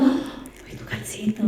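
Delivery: hushed whisper of recognition. Retold or imitated. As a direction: breathe it out secretly.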